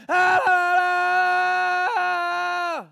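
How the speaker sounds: high, very loud drawn-out la-la; yelling over speech. Acted. People plugging their ears against Stephen's gospel, yelling. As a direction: drown it out with one long high yell. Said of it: "and they yelled".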